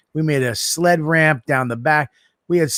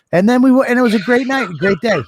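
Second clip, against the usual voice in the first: higher and louder, bursting with joy; excited outburst; 9 semitones higher, 6 dB louder than usual. Real, not acted. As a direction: belt it out like the best day ever. Joyful recollection of a great day.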